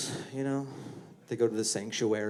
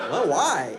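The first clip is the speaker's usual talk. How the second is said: puzzled coworker; 6 semitones higher, 6 dB louder than usual. Acted. Coworker reply.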